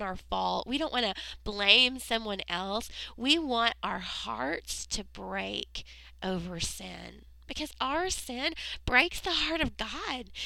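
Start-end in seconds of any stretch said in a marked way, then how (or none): none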